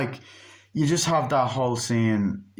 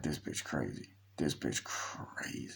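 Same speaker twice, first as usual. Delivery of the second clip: low hushed gossiping whisper; low conspiratorial whisper; low whispered aside; 7 semitones lower, 14 dB quieter, slower than usual. Retold or imitated. Mocking aside about the character.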